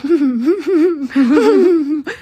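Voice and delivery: högt skratt som gränsar till gråt; skrattanfall. Real, not acted. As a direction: skratta så att det nästan blir gråt. Skrattar tills hon nästan gråter. (skrattar högt i lunchrummet tills det nästan blir gråt)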